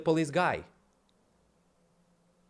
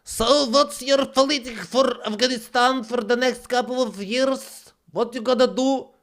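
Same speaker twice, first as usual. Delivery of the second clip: higher, put-on questioning voice; imitating the reporter; 8 semitones higher, 4 dB louder than usual. Imitating the Afghan reporter questioning Trump, a higher put-on voice. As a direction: ask it like the earnest reporter. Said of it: "The lady from Afghanistan who asked Trump".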